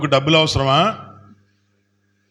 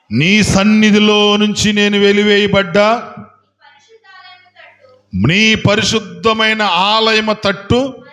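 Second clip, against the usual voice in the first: loud, slow solemn reading; proclaimed scripture; 3 semitones higher, 5 dB louder, slower than usual. Real, not acted. Pastor reading Jonah's prayer to the congregation, loud and slow. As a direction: declaim the verse slowly to the hall.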